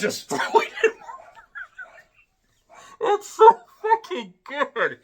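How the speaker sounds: high, loud, words breaking into laughter; helpless real laughing. Real, not acted. Reviewer recalls Krypto throwing Luthor around, cracking up. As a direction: lose it laughing, squeal the words out.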